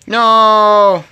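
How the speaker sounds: loud protest; shouting. Real, not acted. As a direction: yell no. Panicked refusal in game.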